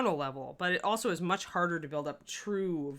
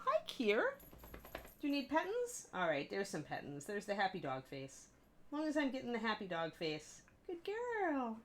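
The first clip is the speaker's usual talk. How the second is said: happy dog voice; 6 semitones higher, 4 dB quieter than usual. Real, not acted. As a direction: coo at the dog like a puppy. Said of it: "That's my, my happy dog voice".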